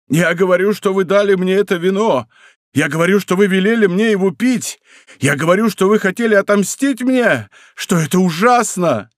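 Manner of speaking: high, insistent acted outcry; anguished accusation. A narrator's reading. Dying soldier accusing d'Artagnan of poisoning, anguished outcry.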